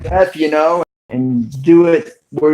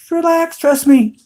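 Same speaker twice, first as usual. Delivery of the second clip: calm wife; 12 semitones higher than usual. Retold or imitated. Wife voiced in retold scene.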